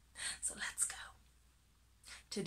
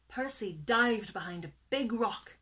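plain reading voice

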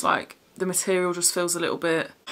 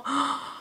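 delighted gasp; 6 semitones higher, 6 dB quieter than usual. Real, not acted. Sees the jumper she loves.

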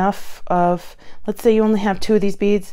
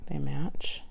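whispering; 4 semitones lower, 8 dB quieter than usual. Real, not acted. Whispered aside.

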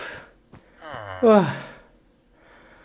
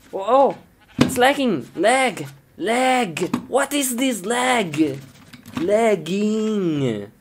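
exasperated whine; 7 semitones higher than usual. Real, not acted. Game lagging.

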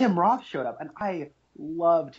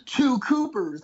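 excited realization; 9 semitones higher, 3 dB louder, much slower than usual. Retold or imitated. Podcast host reliving his shock at the twist, gasping excitedly. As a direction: gasp then a high, louder burst of discovery. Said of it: "then I'm thinking".

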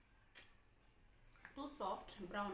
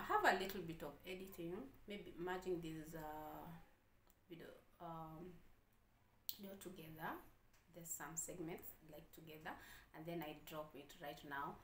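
ordinary talk

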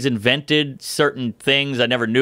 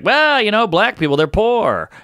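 higher, matter-of-fact mock Norm voice; imitating Norm; 9 semitones higher than usual. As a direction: lightly play the deadpan comic. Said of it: "and he's like".

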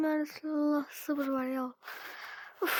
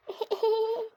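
quick high giggle; short high laugh; 9 semitones higher than usual. Acted. Doll laughing during the play, a short high giggle.

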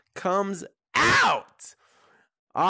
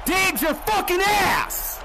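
drawn-out furious yell, pitched higher; angry yell; 9 semitones higher, slower than usual. Real, not acted. Yelling at player in game.

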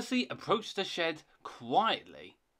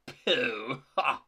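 scoffing laugh; 4 dB louder than usual. Acted. Thomas scoffs at ghost story.

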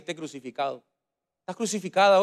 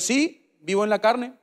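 ordinary talk, nothing marked

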